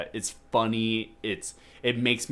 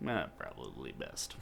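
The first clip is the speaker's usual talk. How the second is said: deadpan Cheney; 11 semitones lower, 14 dB quieter than usual. Retold or imitated. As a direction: lower and much softer, casual understatement. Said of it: "he's like".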